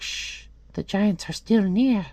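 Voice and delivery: high, slow hushing bird. Acted. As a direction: shush and warn as a little bird. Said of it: "And the bird's like".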